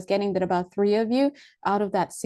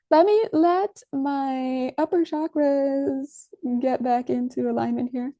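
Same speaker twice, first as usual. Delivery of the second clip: higher, very slow, odd strained sound; slow, strained and higher while settling; voice still realigning; 5 semitones higher, slower than usual. Real, not acted. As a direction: drag the words out while the voice resettles. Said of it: "my voice is still like, my vocal cords".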